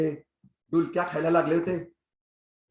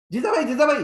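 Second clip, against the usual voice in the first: higher, louder shouted answer; eager shout; 8 semitones higher, 5 dB louder, faster than usual. Retold or imitated. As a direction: blurt it out, hand up high. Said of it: "मी ओरडलो". (उत्तर देताना उंच, मोठ्याने ओरडणे)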